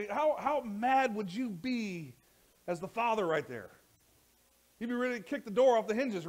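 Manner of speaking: loud, high, impassioned preaching; a preacher at full cry; full-force preaching. Acted. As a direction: build to a fiery peak. Impassioned preaching about angry father.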